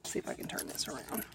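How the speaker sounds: hushed whisper. Real, not acted. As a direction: whisper so she won't hear. Filming secretly in store.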